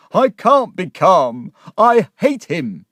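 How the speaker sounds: loud, slow, high-pitched outburst of hatred; agitated shout. Acted. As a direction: shout it, then urge them desperately. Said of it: "he shouted".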